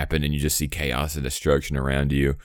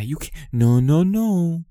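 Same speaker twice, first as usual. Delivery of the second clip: mock studio exec; 12 semitones higher, slower than usual. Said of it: "They're gonna be like".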